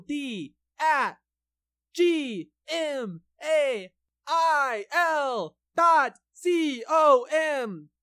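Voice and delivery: each letter spaced out, very slow; spelled out slowly. Shown as a demonstration. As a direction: read the email address letter by letter, slowly. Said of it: "That is Tony Tommy Pod at gmail dot com".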